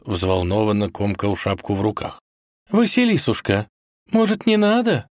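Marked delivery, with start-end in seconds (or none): 2.7-5.1 high-pitched timid auntie voice; hesitant, anxious little plea. A narrator's reading. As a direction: plead meekly, way up high. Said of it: "робко спросила тётя Дуня".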